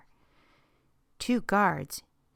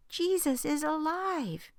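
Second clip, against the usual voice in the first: mild excited shout; 8 semitones higher than usual. A narrator's reading. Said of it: "they shouted".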